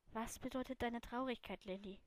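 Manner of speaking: soft, gently falling question; a remembered friend's quiet words; softly recalled question. A narrator's reading. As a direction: ask it softly, letting the name fade.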